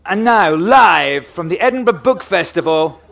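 loud, high, slow announcer call; showbiz introduction. Acted. Host introducing the audience's filmed chant, big announcer voice. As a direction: proclaim it like a live show opener, stretching the words. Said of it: "I'll do the introduction bit first".

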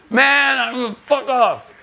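high, loud, exasperated brush-off; doorman's angry dismissal. Retold or imitated. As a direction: yell it like a fed-up bouncer. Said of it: "slams the door in our face".

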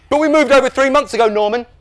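high, loud protesting voice; incredulous dad. A narrator's reading. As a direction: protest to your forgetful son. Incredulous dad argues with son.

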